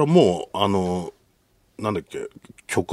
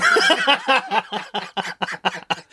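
laugh; 11 semitones higher than usual. Real, not acted. Laughing at crying dad.

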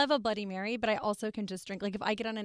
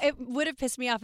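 usual speaking voice

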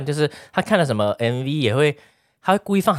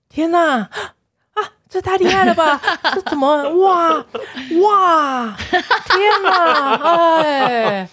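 much higher, loud, hammed-up gasps of wonder with laughs; mock amazed exclamations; 4 dB louder, much slower than usual. Imitating the attention-seeking boyfriend, high and overdone amazement. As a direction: gush like he can't believe how amazing it is. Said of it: "一直发出一种".

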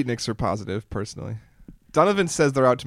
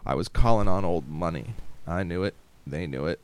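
noir detective; 5 semitones lower than usual. Acted. Noir narration, low and hushed.

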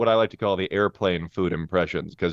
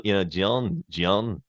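ordinary talk

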